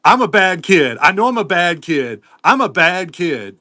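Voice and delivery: hammed-up child's confession; imitating his young son. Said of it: "he goes".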